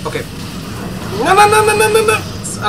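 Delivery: panicked no. Real, not acted. Near death in game.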